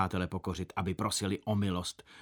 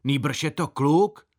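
slow, louder and higher protest; indignant insistence; 4 semitones higher, 6 dB louder, slower than usual. Acted. Boy insisting to gentlemen his brother is a boy, slow and louder. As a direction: insist with wounded pride.

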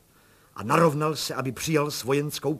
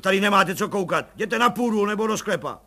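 loud and higher, ordering her off; bellowing; 8 semitones higher, 10 dB louder than usual. Acted. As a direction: roar the maid out of the room. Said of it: "zařval plukovník".

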